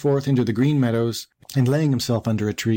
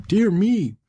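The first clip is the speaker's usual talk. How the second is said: higher-pitched mild exclamation; a touch of surprise, pitched up; startled thought; 9 semitones higher than usual. Acted. Mother goat notices movement inside the wolf, mildly startled. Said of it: "thought she".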